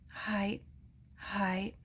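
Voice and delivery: soft, hushed acknowledgement; softly. A narrator's reading. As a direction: barely say it, stunned. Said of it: "he said softly".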